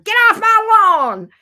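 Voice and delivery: loud, high, drawn-out mock yell; grouchy Capricorn. Reader imitating the client as a Capricorn, grouchy yell. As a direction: holler it like a cranky lawn guard. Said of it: "You sound like the damn Capricorn".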